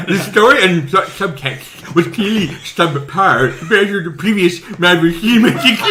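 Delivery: mock snooty critic voice; hammy highbrow critic; put-on voice. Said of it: "I didn't know you could do that voice".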